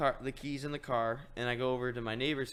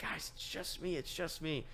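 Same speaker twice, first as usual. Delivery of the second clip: soft, high soothing voice; retold reassurance; 4 semitones higher, 6 dB quieter than usual. Dog sitter calming spooked dogs on the deck, reassuring. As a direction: hush it gently, pitched up.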